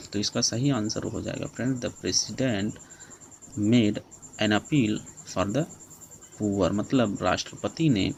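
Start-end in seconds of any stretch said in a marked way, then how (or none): 1.8-6.7 very slow, word by word, so students can write it down; slow dictation. Shown as a demonstration. Teacher dictating the answer to the class, very slowly.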